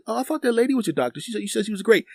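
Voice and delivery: high, quick imitation of a friend; puzzled friend. Friend falling for a doctor setup, high imitated puzzlement.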